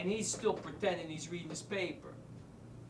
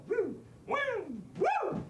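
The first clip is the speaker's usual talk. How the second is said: high rising revving whoops; mimicked motor, pitch leaping upward; chainsaw imitation; 3 dB louder, much slower than usual. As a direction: imitate a chainsaw with escalating shrieky woos.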